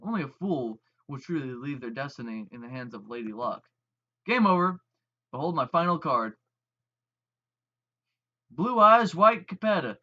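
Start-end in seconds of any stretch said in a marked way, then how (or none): 4.2-10.0 triumphant reveal. Acted. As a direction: slam down the winning card with pride. Dramatic card game reveal.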